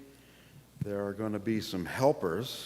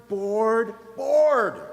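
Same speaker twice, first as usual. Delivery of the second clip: repeated bored rising to a high, louder last bored; building bored; 13 semitones higher, 10 dB louder than usual. A narrator's reading. Hamming Bear's boredom for the kids, building repeats. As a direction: pile up each bored bigger. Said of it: "He is bored".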